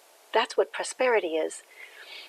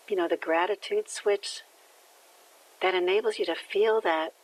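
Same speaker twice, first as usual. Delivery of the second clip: guided meditation. Acted. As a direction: ease them into breathing with gratitude. Leads a gratitude breathing meditation.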